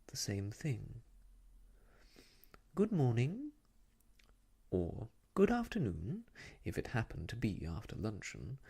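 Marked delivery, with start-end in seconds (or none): 2.8-3.5 high, sing-song lady's greeting; fussy, high-pitched social hello; Miss Lark's greeting. A narrator's reading. Miss Lark's habitual greeting, voiced. 5.3-6.3 high, swooping lady's voice; fussy, sing-song social hello; Miss Lark's greeting. A narrator's reading.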